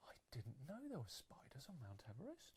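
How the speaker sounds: hushed whisper; soft unvoiced whisper of surprise. Real, not acted. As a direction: whisper it in amazement. Whispered aside.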